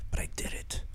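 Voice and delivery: unvoiced whispered aside, half to himself. Real, not acted. Host whispers to viewers after a hard word, unvoiced aside. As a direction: whisper it like a small private victory.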